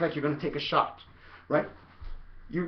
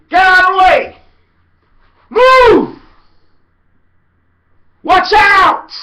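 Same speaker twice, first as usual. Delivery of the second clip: very high, very loud yells; shouting; 14 semitones higher, 16 dB louder, slower than usual. Shown as a demonstration. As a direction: yell them out of your way. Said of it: "So you just can say".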